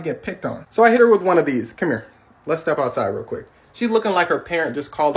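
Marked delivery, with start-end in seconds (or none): 1.7-3.5 stern, low aside. Retold or imitated. Pulling teacher aside to confront.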